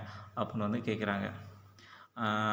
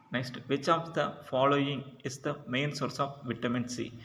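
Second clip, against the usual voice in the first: each English word read out slowly and clearly, a little higher; slow question reading; 4 semitones higher, much slower than usual. Shown as a demonstration. Teacher reading the quiz question to students, slow and deliberate.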